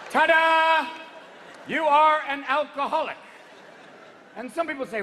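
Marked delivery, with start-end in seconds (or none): 0.1-3.2 high, loud showman's ta-da; triumphant reveal. Acted. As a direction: announce a prize with mock fanfare. Presenting the punchline like a reveal.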